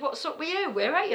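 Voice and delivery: high-pitched worried questioning, acted out; imitated panic. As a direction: mimic a caller getting panicky. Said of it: "he were getting panicky".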